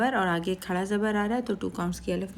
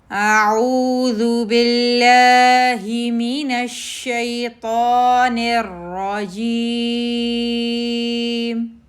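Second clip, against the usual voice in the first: very slow, loud, higher melodic tarteel; Quran recitation; 5 semitones higher, 8 dB louder, much slower than usual. Acted. Teacher models the opening recitation for students, loud slow melodic chant. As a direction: chant it out long and ringing.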